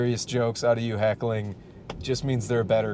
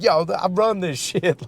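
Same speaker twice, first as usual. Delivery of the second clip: mock tough guy; 5 semitones higher than usual.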